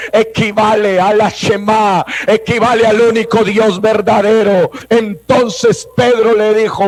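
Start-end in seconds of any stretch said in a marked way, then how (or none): none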